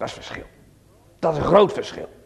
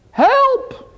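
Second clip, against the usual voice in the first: cry for help; 6 dB louder than usual. Acted. Joseph crying from the pit.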